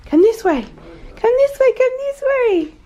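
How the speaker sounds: high, slow, stretched calling; sing-song coaxing. Real, not acted. Coaxing toddler to window.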